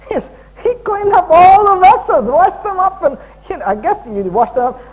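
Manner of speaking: high, loud delighted praise; excited praise. Real, not acted. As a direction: gush about the pastor washing dishes.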